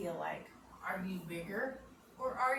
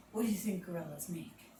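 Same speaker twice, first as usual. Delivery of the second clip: ordinary talk, nothing marked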